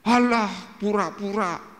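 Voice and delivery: acted wheedling dismissal, high; imitating scoffing friend. Acts friend scoffing at him.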